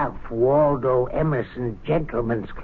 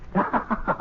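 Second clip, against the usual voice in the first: breathy chuckle; 4 semitones higher, 3 dB quieter than usual. Acted. Chuckling at members' worry.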